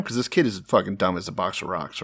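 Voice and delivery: ordinary delivery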